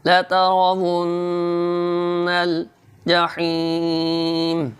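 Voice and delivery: slow chanted recitation; तजवीद से तिलावत. Shown as a demonstration. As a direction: chant it drawn out for students to copy. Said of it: "Urdu तलफ़ुज़". (धीमी सुरीली तिलावत)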